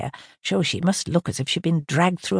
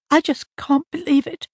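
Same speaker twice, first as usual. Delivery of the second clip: muffled voice; 10 semitones higher than usual. A narrator's reading. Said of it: "said in a muffled voice".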